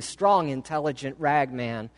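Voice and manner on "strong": ordinary delivery, nothing marked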